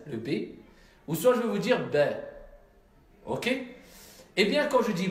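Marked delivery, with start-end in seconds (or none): 1.9-2.0 B said "bè", between heavy BA and French "bé"; light open è after the B; Arabic tarqiq B, neither rounded nor tight; in-between, thinned vowel. Shown as a demonstration. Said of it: "Entre les deux".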